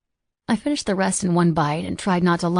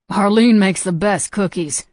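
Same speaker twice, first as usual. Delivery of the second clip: mouth full; 2 semitones higher, 6 dB louder, slower than usual. A narrator's reading. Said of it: "through a mouthful of food".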